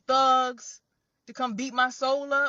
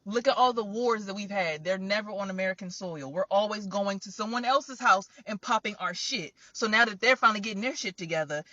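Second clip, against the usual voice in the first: usual speaking voice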